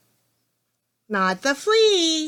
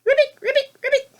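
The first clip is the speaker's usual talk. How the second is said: high, loud imitated ribbits; frog croaks; 13 semitones higher, 5 dB louder, faster than usual. Reader answering what a frog says, high frog imitation. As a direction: ribbit three times like a frog, pitched up. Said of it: "What does a frog say?".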